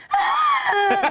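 high excited scream. Retold or imitated. Singer reenacts screaming at her proposal, high scream. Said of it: "I was screaming".

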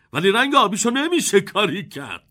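ordinary delivery

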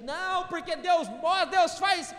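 loud, high, hammed-up shout; mock shouting believer. Pastor mocking zealous churchgoers, hammed-up shout. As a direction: parody an overzealous believer yelling. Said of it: "E eu vejo tanta gente".

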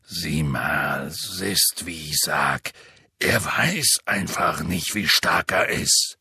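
deep rough half-giant voice; low booming gamekeeper; Hagrid voice. Acted. Hagrid pleads.

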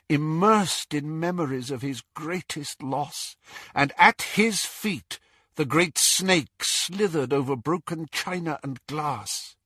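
usual speaking voice

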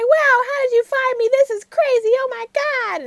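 put-on thrilled to see his old friend; excited. Excited friend gushing at the driver, high excited voice.

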